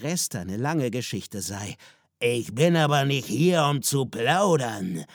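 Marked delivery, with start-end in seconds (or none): 2.2-5.0 stern father. A narrator's reading. Father tells his children this is no chat, stern and loud. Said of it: "sagte er".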